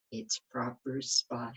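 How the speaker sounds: ordinary delivery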